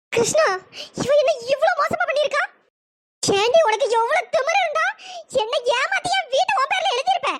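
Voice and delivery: high-pitched wailing outrage; outraged yelling. Acted. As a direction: cry out at being cheated of your house. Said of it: "சும்மா நின்னு கத்திட்டு இருக்காத".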